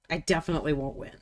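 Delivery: ordinary delivery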